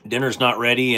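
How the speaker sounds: ordinary talk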